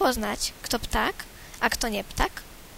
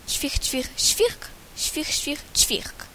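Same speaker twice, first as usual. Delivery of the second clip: sparrow chirping; slower than usual. Acted. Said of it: "wróbel zaterlikał".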